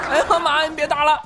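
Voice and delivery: high, laughing whine, pleading with mom; hammed-up kid pleading not to be hit; mock child begging. (边笑边装小孩求妈妈别打)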